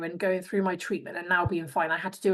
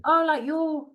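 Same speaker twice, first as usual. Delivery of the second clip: surprised realisation; 9 semitones higher, 4 dB louder, slower than usual. Retold or imitated. Realising coach is serious.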